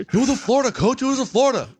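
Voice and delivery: very high squeaky put-on voice; mock panicked freshman. Freshman insists to coach on the play, hammed-up squeal. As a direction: squeal it repeatedly like a flustered rookie.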